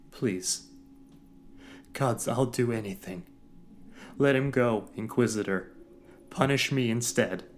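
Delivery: loud high desperate pleading; desperate begging. Acted. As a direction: beg with everything left. Captive begging for father.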